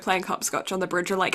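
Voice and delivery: usual speaking voice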